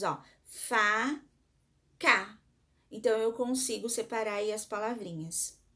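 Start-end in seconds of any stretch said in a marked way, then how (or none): 0.4-2.4 fa, ca said as two separate beats, each one louder; teacher spelling faca into syllables for the class; syllables split apart. Shown as a demonstration. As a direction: tap out fa then ca like a teacher counting. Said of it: "Nós emitimos duas vezes".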